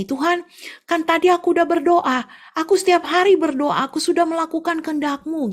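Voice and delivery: high, loud complaining to God; protesting to God. Acted. Tofu seller protesting to God, high complaining voice. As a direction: whine your grievance upward. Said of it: "dia protes sama Tuhan".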